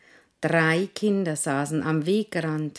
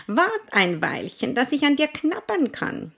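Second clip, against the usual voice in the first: Maus; 9 semitones higher, 4 dB louder than usual. Acted. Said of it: "sagte die Maus".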